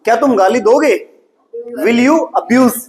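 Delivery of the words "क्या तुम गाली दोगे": usual speaking voice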